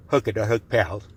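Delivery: talking through a yawn. Real, not acted. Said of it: "I'm yawning".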